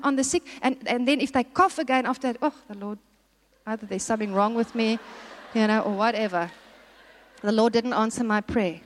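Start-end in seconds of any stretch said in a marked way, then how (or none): 2.4-8.8 lightly acted dejection, pitched low; mock discouraged. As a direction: imitate a believer giving up, low and subdued. Voices a discouraged believer's complaint.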